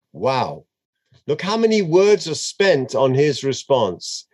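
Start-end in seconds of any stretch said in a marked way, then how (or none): none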